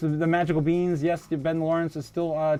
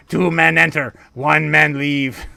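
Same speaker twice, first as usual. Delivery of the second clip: a booming Thunderdome announcer, louder and drawn out on the last words; Mad Max quote; 7 dB louder, slower than usual. Retold or imitated. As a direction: streamer calls out the Thunderdome line to chat, booming movie-announcer voice. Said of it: "reminds me of the Thunderdome".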